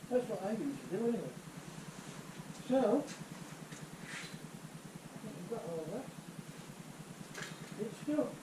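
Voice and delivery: muffled, as if through a mask. Real, not acted. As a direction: talk through a face mask. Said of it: "if you can hear my muffled voice".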